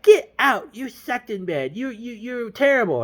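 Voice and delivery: high-pitched indignant woman; mock outrage. Girl kicks James out next morning, mock outrage. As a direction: throw him out in put-on falsetto fury. Voices girl throwing him out.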